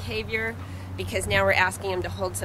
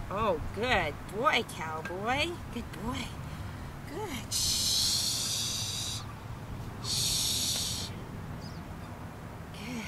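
soft praise; 7 dB quieter, much slower than usual. Real, not acted. Praising the calmed dog softly.

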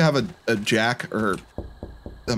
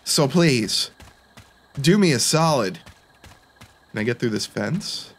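ordinary talk, nothing marked

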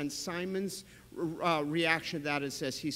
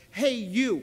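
loud Hey, you to grab attention; calling out; 5 semitones higher, 8 dB louder than usual. Shown as a demonstration. Jesus getting Simon's attention, calling out. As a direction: shout to get someone's attention. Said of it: "He gets his attention".

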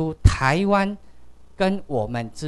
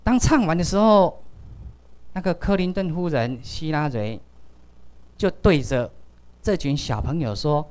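ordinary talk

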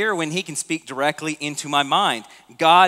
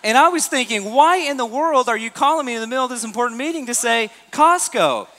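laughing disbelief; 8 semitones higher than usual. Retold or imitated. Laughing incredulous retelling.

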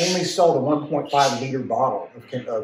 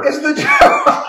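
very high, loud squeal of discovery; shrieking excitement; 9 dB louder than usual. Real, not acted. Host confirming the Joker reveal to friends, high excited squeal. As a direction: squeal it like you've cracked the case.

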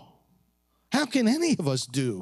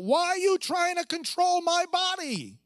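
mock yell; 12 semitones higher than usual. Pro-abortion woman at the senator, mock yelling. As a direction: mimic a woman yelling out, pitched way up. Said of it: "she yelled out".

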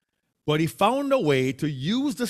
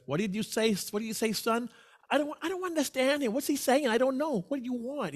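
high-pitched mock New York customer; pitched-up voice of a confused Bronx customer; imitating baffled New Yorkers; 8 semitones higher, 3 dB quieter, faster than usual. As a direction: mimic a customer who can't understand.

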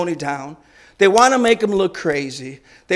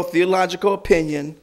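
ordinary talk

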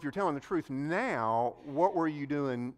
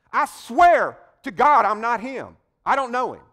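desperate shout; 9 semitones higher, 13 dB louder than usual. Acted. A preacher voicing Peter, shouting his sworn denial. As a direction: swear it out loud in panic.

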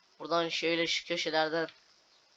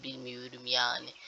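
ordinary delivery

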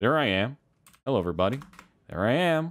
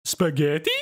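higher mock Italian accent; Mario; 8 semitones higher, slower than usual. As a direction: ham it up as an Italian plumber. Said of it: "it's me, Mario".

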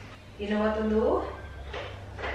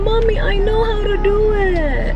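put-on higher, slower little-girl voice; imitating her daughter; 8 semitones higher, 4 dB louder, slower than usual. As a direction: mimic a small girl insisting she can do it. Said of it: "Sabi niya".

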